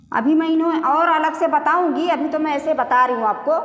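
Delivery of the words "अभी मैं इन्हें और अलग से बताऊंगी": usual speaking voice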